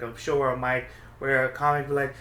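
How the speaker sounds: ordinary delivery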